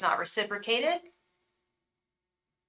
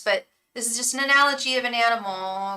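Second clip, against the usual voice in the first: ordinary talk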